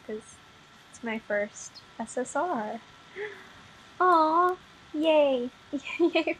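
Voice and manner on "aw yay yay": high, loud squeal of delight; happy cheer. Real, not acted. Player celebrating a first top-rarity pull, happy cheer. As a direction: cheer it out, pitched up.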